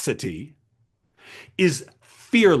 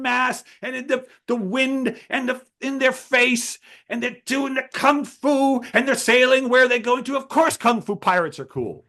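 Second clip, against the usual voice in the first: higher and eager, gushing about pirates; excited young writer; 7 semitones higher than usual. Retold or imitated. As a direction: let the kid's enthusiasm spill over. Young man defends his idea.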